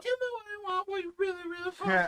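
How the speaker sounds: high wailing mock cry; mock whiny plea. Teasing the story with a high mock wailing plea. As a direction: whine it like a begging diva.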